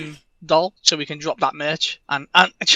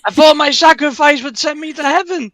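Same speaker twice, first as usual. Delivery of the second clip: high, louder mock-heroic lament; pitched-up dying hero; 11 semitones higher, 7 dB louder than usual. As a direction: whine it like a burning hero. Burning Henry voiced in sketch.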